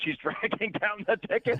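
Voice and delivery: amused, laughing through the words. Real, not acted. Host laughing about a panel's old complaint, laughing. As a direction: say it through a laugh, pitched high.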